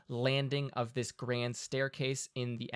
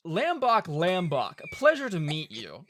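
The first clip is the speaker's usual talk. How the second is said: hearty greeting voice; 4 semitones higher, 5 dB louder than usual. Acted.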